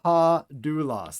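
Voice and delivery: teacher modelling new Greek words aloud; slow word demo. Shown as a demonstration. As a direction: sound out "ha" and "doulos" for the class. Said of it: "our next one".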